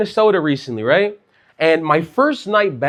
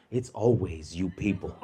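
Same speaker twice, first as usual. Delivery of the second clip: put-on sneering old man; 11 semitones lower, 13 dB quieter than usual.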